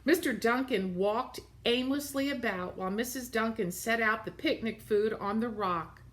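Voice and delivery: ordinary delivery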